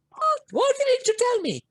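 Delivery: mother gasps in disbelief; shocked mother. Retold or imitated. Dying mother reacting.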